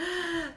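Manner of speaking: high, audible sucked-in gasp; mock anxious gasp. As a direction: gasp in exaggerated alarm. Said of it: "like that".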